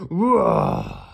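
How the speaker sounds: loud, high, hammed-up roar; toy dinosaur roar. A narrator's reading. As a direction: roar like a wind-up toy monster.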